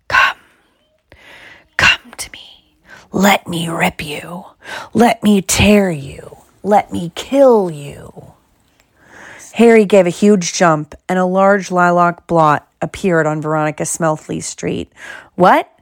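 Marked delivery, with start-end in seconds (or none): 0.1-8.3 slow, menacing whisper; chilling venomous voice. A narrator's reading. A bodiless voice calls to Harry, chilling slow whisper. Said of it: "A voice to chill the bone marrow". 15.3-15.7 loud, higher-pitched "what"; loud startled outburst. A narrator's reading. Harry jumps at the voice, blurting loudly. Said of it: "he said loudly".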